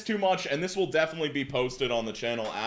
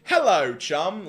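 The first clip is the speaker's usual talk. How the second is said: loud, higher mock British greeting; British accent; 6 semitones higher, 8 dB louder, slower than usual. Greeting someone at the gym, in a mock British accent. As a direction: greet your mate like a hammy Brit. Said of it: "this is my safe space for my British accent".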